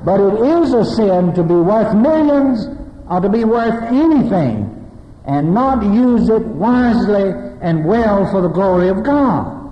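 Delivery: impassioned preaching, building. Acted. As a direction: drive the point home from the pulpit. Preaching with rising force.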